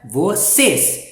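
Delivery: "vocês" slowly split, weight on CÊS; stress on last syllable. Shown as a demonstration. Teacher pointing out the accented word, stressed last syllable. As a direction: land firmly on the "cês" of "vocês". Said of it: "um pouco mais de força".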